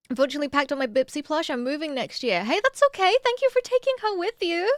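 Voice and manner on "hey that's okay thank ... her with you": much higher, gentle thanks; sweet high reassurance. Real, not acted. Comforting a sad viewer.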